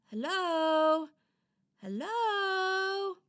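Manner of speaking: high, loud, drawn-out doll call. Acted. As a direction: call to an unseen stranger. Doll calls into the dark.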